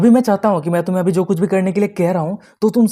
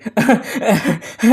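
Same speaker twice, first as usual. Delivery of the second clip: acted sheepish chuckle; awkward laugh; 3 semitones higher than usual. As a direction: laugh it off as a cover-up. Covering up awkwardly as Sylvie. (झेंपी हुई बनावटी हँसी)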